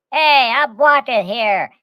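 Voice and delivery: loud, slow, high New York "I'm walking here"; New York yell. Retold or imitated. New Yorker yelling at a driver, loud and slow. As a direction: bark it like a cabbie cutting off a pedestrian. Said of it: "they're like".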